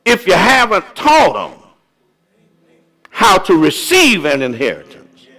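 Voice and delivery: high-pitched pulpit exhortation in spaced phrases; emphatic preaching. Real, not acted. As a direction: urge the congregation, letting each phrase fall. Exhorts the congregation.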